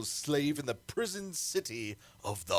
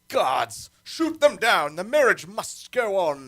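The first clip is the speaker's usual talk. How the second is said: loud, higher barked orders; furious command; 6 semitones higher, 8 dB louder than usual. Acted. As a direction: order the guards to fire. Tyrant orders guards.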